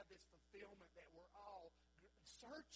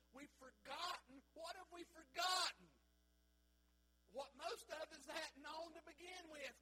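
loud, high, rousing preaching to the church; full force preaching; 8 semitones higher, 11 dB louder than usual. Acted. Pastor rousing his congregation, loud fervent preaching. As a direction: drive it home from the pulpit, near shouting.

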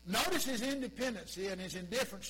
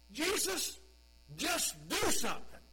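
loud pleading mother, pitched up; Mary pleading; 7 semitones higher, 6 dB louder than usual. Pretend. Mary urging her son Jesus, loud plea. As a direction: urge Jesus to act now. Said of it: "Here's Mary's thought".

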